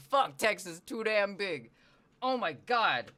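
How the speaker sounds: high, loud, drawn-out disbelief; real incredulous rant. Real, not acted. Streamer marvelling at how big Texas is, incredulous. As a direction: stretch the words in loud exasperated amazement.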